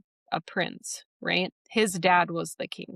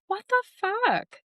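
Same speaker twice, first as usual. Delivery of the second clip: much higher, stunned outburst of disbelief. Retold or imitated. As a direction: let the shock shoot the voice way up. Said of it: "And I was just like".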